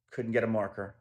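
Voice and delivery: ordinary delivery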